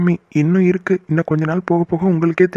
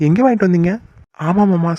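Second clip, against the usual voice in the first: ordinary delivery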